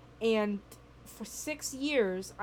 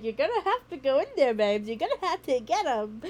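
self pep talk; 4 semitones higher, 6 dB louder than usual. Retold or imitated. Talking herself into entering.

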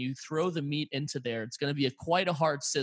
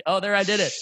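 ordinary talk

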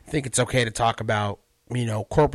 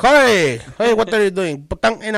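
loud, high Filipino-accented greeting; 8 semitones higher, 8 dB louder than usual. Shown as a demonstration. Hailing a pal as an example, loud Filipino accent. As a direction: call out in a comic Filipino accent. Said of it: "that's how they talk".

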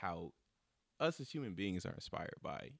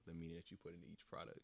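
ordinary talk

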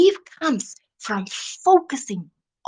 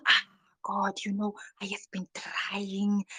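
whispered weak excuse, much softer; mock a half-hearted whispered excuse; whiny whisper; 7 dB quieter than usual.

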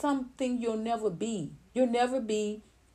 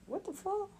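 shocked; 12 semitones higher, 8 dB quieter than usual. Real, not acted. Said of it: "Yeah, I'm sorry".

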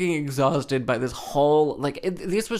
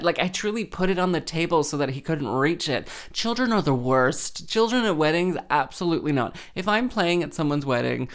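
ordinary delivery

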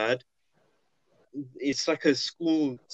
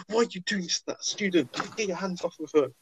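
retold teacher's higher-pitched scolding; lightly acted outraged challenge; indignant teacher; 6 semitones higher than usual. Teacher confronting the man over his student, retold indignantly.

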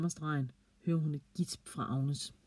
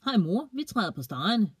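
bright cheerful greeting, higher and louder; happy; 6 semitones higher, 5 dB louder than usual. A narrator's reading. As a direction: proudly tell mum. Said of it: "siger Ida glad".

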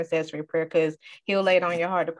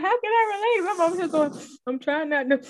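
much higher, helpless retold wail; flustered protest; 11 semitones higher than usual. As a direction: plead it high, as if barely holding on. Retold struggle to relax while crying.

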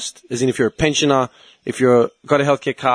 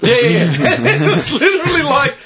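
laughing; 10 semitones higher, 4 dB louder than usual. Real, not acted. Laughing while retelling the hospital.

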